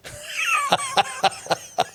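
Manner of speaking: sudden high laugh; burst of laughter. Real, not acted. Laughs at own title.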